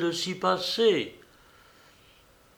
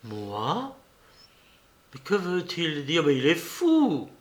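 confused exclamations; 2 semitones higher than usual. A narrator's reading. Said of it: "quelques exclamations confuses".

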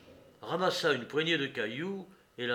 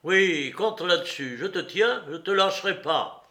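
loud, higher, dogged insistence; angry threat; 6 semitones higher, 9 dB louder than usual. A narrator's reading. Courrapier won't let the woman go, threatening. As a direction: warn her she won't get away. Man threatens her in quarrel.

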